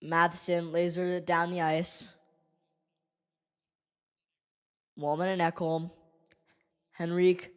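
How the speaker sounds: deflated fan commentary; subdued play-by-play. Acted. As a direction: murmur the play as it unfolds. Calls play-by-play quietly.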